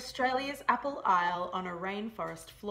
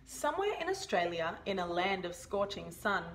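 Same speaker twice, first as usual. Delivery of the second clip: usual speaking voice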